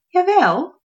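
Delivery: high, light fairy voice. Acted. Fairy answering the gnome, high airy voice. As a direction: lift it airily like a fairy. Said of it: "zei ze".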